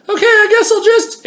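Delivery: very high, sing-song resigned stranger; deflated chirpy human. Acted. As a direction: pipe it up high, then give up. Human voice gives up.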